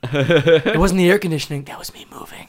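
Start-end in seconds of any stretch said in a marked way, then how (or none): 1.7-2.5 breathy whisper right behind them; dark whisper behind you. Pretend. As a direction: whisper it like you're in the room. Creepy bit, unvoiced.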